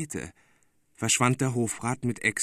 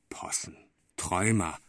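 whispered; 5 semitones lower than usual. A narrator's reading. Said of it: "rief Exter".

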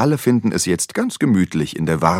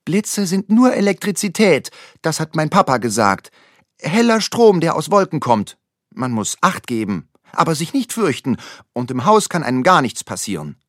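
put-on higher, eager little-girl voice; girl comforting her scared friend; girl calling out; 8 semitones higher, 3 dB louder than usual. A narrator's reading. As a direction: reassure your friend brightly. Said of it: "drückt seine Hand und ruft".

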